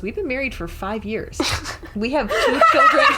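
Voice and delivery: words rising high into a laugh; laughing husband quote. Retold or imitated. Friend acting the husband's reply, laughing through it. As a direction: crack up on the punchline. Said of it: "He goes".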